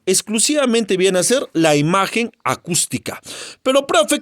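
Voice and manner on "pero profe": high, eager student asking the teacher; student's question. Acted. Student calls out to the profe in a high voice. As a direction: play the pupil calling out to the teacher.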